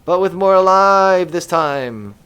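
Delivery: loud, high, hammed-up astonishment; mock amazement. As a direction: exclaim it in exaggerated disbelief. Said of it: "And we'll be like".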